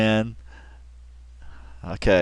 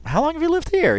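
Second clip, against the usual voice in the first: mimicking her earnest curiosity, higher; sincere question. Host replays the investigator's earnest question, higher and sincere. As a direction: ask it with genuine interest, pitched up. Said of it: "It's really sincere".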